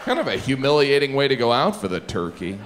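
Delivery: ordinary talk, nothing marked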